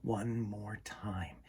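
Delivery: slow, soft whisper; slow storyteller whisper. A narrator's reading. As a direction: breathe it out slowly, hushed. Storyteller's hushed moment.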